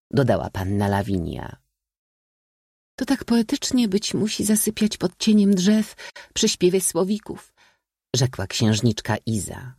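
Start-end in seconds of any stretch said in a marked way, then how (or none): 3.0-7.5 high, airy-headed lady's voice; princess. A narrator's reading. Princess musing on sleeping under trees, high-voiced.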